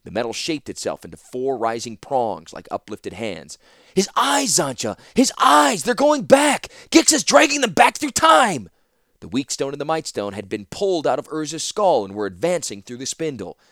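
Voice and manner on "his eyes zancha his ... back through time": high, loud panicked cries; alarmed shout. Acted. Ratapi shouting to Zancha about Urza's eyes, high panicked shout. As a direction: scream the warning in panic.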